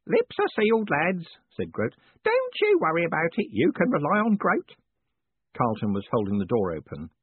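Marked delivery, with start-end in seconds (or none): 0.1-1.2 high-pitched, conspiratorial character voice; high, eager-to-please reassurance; obliging Grote. Acted. 2.2-4.7 high-pitched, conspiratorial character voice; high, eager-to-please reassurance; obliging Grote. Acted.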